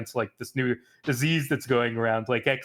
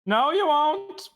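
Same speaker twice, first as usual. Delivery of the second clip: sudden high, slow, eerie voice; high creepy retort; 14 semitones higher, 3 dB louder, slower than usual. Acted. DM ominous NPC retort.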